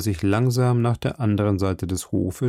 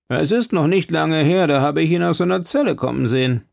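lightly voiced warder; 6 semitones higher than usual. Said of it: "sagte ein Wärter".

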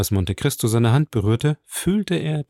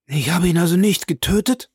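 high, aghast question; shocked disbelief; 7 semitones higher than usual. A narrator's reading. Bertuccio realizes Villefort survived him, shocked. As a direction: ask it in horrified disbelief. Bertuccio learns his victim lives.

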